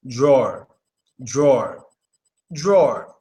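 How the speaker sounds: "drawer" with a deep A, tɹɔɹ. Shown as a demonstration. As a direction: say "drawer" low and falling with a deep A. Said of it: "with a deep A".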